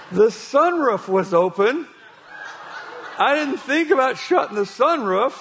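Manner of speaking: can barely keep a straight face; preacher cracking up at the giraffe tale; laughing through story. Retold or imitated. Retelling absurd giraffe story.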